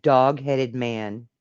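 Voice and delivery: drawn-out, each word leaned on; slow emphatic echo. Retold or imitated. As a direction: savour every word slowly. Echoing a weird quote, savouring it.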